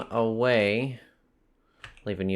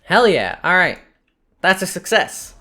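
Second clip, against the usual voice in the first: excited; 8 semitones higher, 7 dB louder than usual. Real, not acted. Cheering a win in game.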